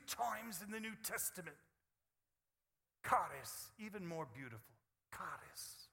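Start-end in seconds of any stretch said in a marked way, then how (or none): 3.1-3.7 "Charis" hit loud with a falling tune. Shown as a demonstration. Preacher introducing the Greek word, said loud. 5.1-5.9 "Charis" breathed as a whisper, falling. Shown as a demonstration. Preacher savouring the Greek word, whispered.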